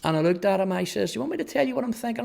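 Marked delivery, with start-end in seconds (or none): 1.1-2.2 fast, high, cheeky retort. Retold or imitated. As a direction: throw the question back at him cheekily. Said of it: "And I was cheeky".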